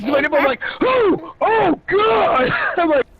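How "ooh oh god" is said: high mock yelp of shock; reenacted pain cry. Friend getting tased by Dad, high reenacted pained yelp. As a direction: yelp it high, reliving the zap.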